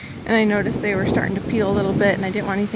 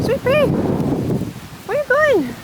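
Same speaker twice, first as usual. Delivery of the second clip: high calling; 14 semitones higher, 4 dB louder than usual. Real, not acted. Calling after a wandering horse.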